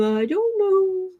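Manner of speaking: high, slow, drawn-out mock innocent; feigned ignorance. Mocking a guilty party playing dumb, high and slow.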